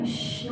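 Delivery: imitated hushing "shh"; callar con un shh. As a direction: shush someone mid-sentence. Mimics crowd silencing the believer. (siseo de quien manda callar)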